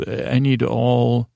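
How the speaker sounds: normal talking voice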